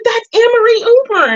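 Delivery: excited. Retold or imitated. Excited recognition, retold.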